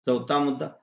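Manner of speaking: usual speaking voice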